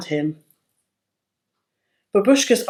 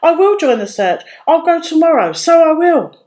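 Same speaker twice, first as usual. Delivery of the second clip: much higher and louder burst of resolve; excited cry; 9 semitones higher, 6 dB louder than usual. A narrator's reading. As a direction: jump up and cry it out. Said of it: "she cried".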